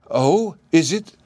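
high, slow relieved cry. A narrator's reading. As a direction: breathe out in relief. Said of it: "she cried, much relieved".

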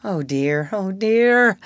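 exasperated sigh, the second one rising. Acted. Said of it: "sighed Wendy".

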